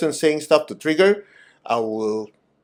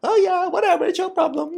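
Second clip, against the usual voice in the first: high, careless "whatever"; dismissive brush-off. Retold or imitated. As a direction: shrug someone off, high and light. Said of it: "you're not rude when somebody's angry".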